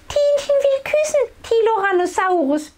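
put-on very high, quick chattering baby dino voice. A narrator's reading. Baby sister wanting to kiss big brother, quick high chatter. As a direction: chatter fast like a tiny baby. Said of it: "plappert Tienchen".

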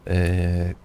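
ordinary delivery

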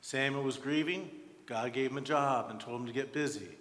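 ordinary talk, nothing marked

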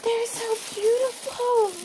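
high, slow gushing; overcome with delight. Real, not acted. Vlogger admiring her new shoes, high overcome gushing. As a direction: gush in a high, drawn-out voice.

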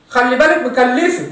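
ordinary delivery

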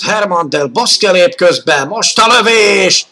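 rising to a shout on the shot; excited commentary. Real, not acted. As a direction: call the shot loud and high. Shot on goal, voice rises.

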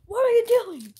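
high, loud startled cry; startled yelp. Real, not acted. Reacting to a sudden mishap with a loud startled cry. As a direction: blurt it out in shock. Startled by something mid-opening.

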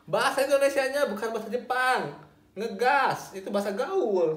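high-pitched, mock-exasperated protest; teacher's playful outburst, high-pitched; exasperated correction. Real, not acted. Exasperated correction of a wrong answer.

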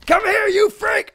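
drawn-out high, loud yell; high battle cry; aggressive shout. Real, not acted. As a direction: holler it slowly after a fleeing rival. Chases opponent in game.

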